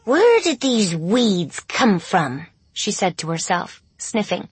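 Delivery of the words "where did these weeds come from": slow, louder growly dog. A narrator's reading. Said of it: "a raspy growl sounded in her throat".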